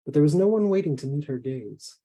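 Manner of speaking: ordinary delivery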